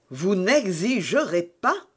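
"Vous n'exigerez pas" pulled apart syllable by syllable, ending on a questioning rise; astonished, syllable-stressed. A narrator's reading. Buckingham astonished at Felton's demand, "Vous n'exigerez pas" stressed syllable by syllable. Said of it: "appuyant sur chacune des syllabes".

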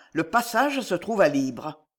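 ordinary delivery, nothing marked

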